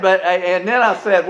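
ordinary talk

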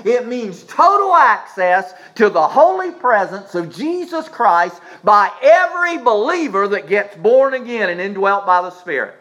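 high, driving preacher's delivery; full-force preaching. Real, not acted. Preacher declaring access for every believer, full-force preaching. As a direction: build the argument with urgent force.

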